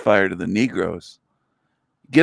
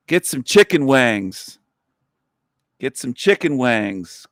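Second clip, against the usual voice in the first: ordinary delivery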